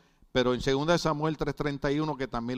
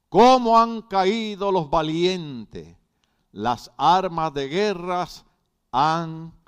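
slow, loud, grave proclamation of the verse; solemn scripture reading; 4 semitones higher, 7 dB louder, much slower than usual. Acted. Preacher reads the lament of David to the church, slow and loud. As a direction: lament it slowly to the congregation.

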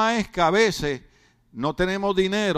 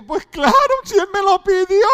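innocent widow voice; 11 semitones higher, 6 dB louder than usual. Retold or imitated. Widow answering her friend.

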